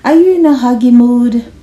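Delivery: ordinary delivery, nothing marked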